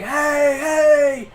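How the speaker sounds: high, loud calling shout; screaming. Acted. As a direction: yell to draw a creature's attention. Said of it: "he's screaming at this thing".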